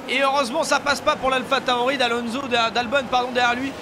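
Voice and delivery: excited race-start play-by-play, high and loud; motorsport commentator. Acted. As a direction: call the start like live TV. (commentateur sportif survolté)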